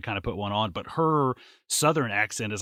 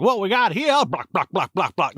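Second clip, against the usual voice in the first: Foghorn Leghorn; 8 semitones higher, 6 dB louder than usual. Retold or imitated. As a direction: squawk it out like a blustering cartoon rooster. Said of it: "like, Foghorn Leghorn".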